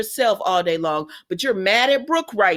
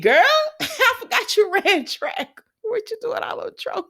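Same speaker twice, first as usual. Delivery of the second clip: high laughing mock Ridge voice, much higher; Ridge teasing; 13 semitones higher than usual. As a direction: tease her through a laugh, pitched up. Recapping Ridge teasing Taylor.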